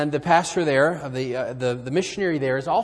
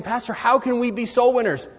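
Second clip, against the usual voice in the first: eager question; 10 semitones higher, 7 dB louder than usual. Retold or imitated. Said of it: "they're saying".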